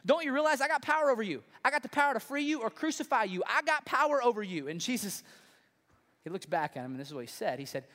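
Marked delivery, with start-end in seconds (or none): 0.0-4.7 higher, louder, imitating an exasperated governor; a mock-frustrated ruler pressing his prisoner; frustrated Pilate. Preacher voicing Pilate demanding Jesus answer him, higher and louder. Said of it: "Pilate's getting frustrated".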